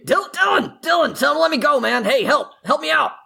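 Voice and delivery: high, urgent cry for help; pleading shout. Acted. Press-ganged half-orc to Dylan, high pleading shout. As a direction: call out high to a friend, begging rescue. Dragged recruit begs friend for rescue.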